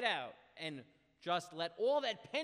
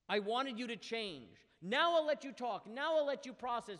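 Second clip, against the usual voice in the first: stern insistence; 4 semitones higher, 7 dB louder than usual. Retold or imitated. God's stern address to people.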